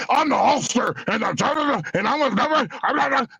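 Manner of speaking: high-pitched wrestler promo patter; Hulk Hogan. Retold or imitated. Wrestler shooting a commercial spot, hyped-up promo voice. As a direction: imitate a wrestler turned on for the camera. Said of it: "He knew how to turn that thing on and off".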